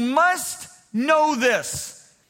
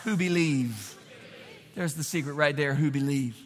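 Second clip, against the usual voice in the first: much lower and softer on "who believe"; hushed reveal; 7 semitones lower, 5 dB quieter than usual. Acted. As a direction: share the secret quietly. Drops to secret after shouting.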